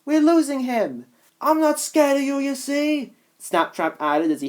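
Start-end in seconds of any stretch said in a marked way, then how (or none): 0.1-1.1 alarmed. Acted. Kitty alarmed. 1.4-3.1 defiant. Acted. Snaptrap defiant.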